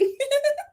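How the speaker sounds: quick high disbelieving laugh; a short squeak of a laugh. Real, not acted. Laugh after story.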